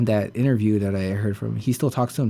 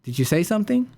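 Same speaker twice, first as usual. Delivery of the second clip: high questioning voice, rising at the end; imitated girlfriend; 10 semitones higher than usual. Girlfriend coming out of the shower asks, high and questioning. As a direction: lightly mimic a girlfriend calling a puzzled question. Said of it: "And she said".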